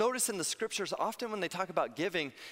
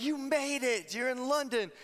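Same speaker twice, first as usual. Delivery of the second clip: put-on much higher, bright praise to a little kid; cheering a child; 8 semitones higher than usual. Parents telling their son he reached London, cheering. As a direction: sell him on his big achievement. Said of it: "And they're like".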